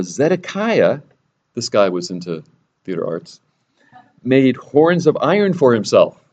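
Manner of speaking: ordinary delivery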